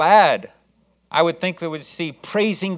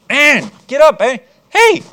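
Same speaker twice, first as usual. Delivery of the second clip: sharp rising yell of hey; shouting to wake someone; 10 semitones higher, 9 dB louder, slower than usual. Acted. Angel yelling at sleeping Peter to wake up. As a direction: shake him awake with your voice.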